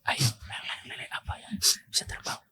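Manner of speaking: whispering. Real, not acted. Whispered riddle repeated.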